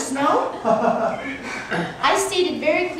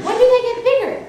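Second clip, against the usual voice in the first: high, loud question broken by laughter; laughing question; 8 semitones higher, 7 dB louder, much faster than usual. Retold or imitated. Asking her friends about snowflakes while laughing.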